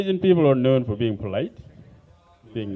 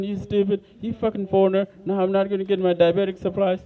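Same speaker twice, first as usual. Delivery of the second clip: put-on higher, shrieking old lady on the phone; mock angry old lady; 6 semitones higher than usual. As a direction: mimic a furious old caller. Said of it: "Yell at me on the phone".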